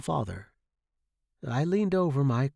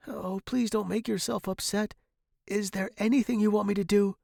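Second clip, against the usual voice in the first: high, worried appeal; anxious pleading; 9 semitones higher than usual. A narrator's reading. Student to upset professor, anxious. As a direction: plead gently in a raised pitch.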